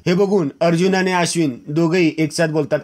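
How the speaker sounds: ordinary delivery, nothing marked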